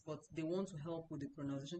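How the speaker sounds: ordinary talk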